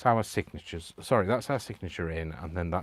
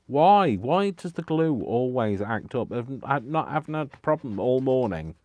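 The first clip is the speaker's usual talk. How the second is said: higher, louder protest at the glue; exasperated complaint; 5 semitones higher, 6 dB louder than usual. Real, not acted. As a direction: whinge in loud exasperation. Mock complaint at uncooperative glue.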